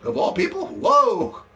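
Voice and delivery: very high, startled whoa; surprised shout. Real, not acted. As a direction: react to an unexpected donation. Surprised by a donation.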